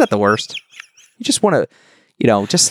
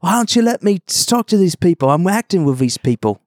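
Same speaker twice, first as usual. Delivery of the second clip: mock [name]; 9 semitones higher than usual. Host imitates the star, caught out, in a high voice. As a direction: play the star all innocent and high. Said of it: "he'd be like".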